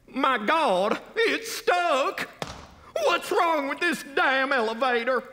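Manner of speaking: high-pitched panicked cries; crying out. A narrator's reading. Trapped passengers in the stuck elevator, high panicked cry. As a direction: cry out in rising alarm. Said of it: "they all cried".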